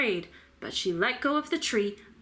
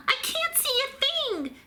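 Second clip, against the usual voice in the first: very high panicked yell. A narrator's reading. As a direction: yell it out in the dark. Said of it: "yelled Pip".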